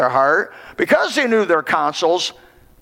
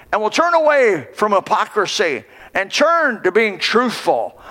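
raised, drawn-out pulpit preaching; fiery preaching; 3 semitones higher than usual. Acted. Preacher calling his congregation to turn from darkness, raised preaching. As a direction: build the call like a revival preacher.

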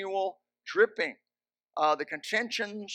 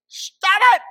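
sudden high shout mocking a kind teacher; a teacher's "kind voice" blown into a yell; mock nice kind voice; 15 dB louder than usual. Preacher mocking a teacher's nice kind voice, high sudden yell. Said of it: "in a nice, kind voice".

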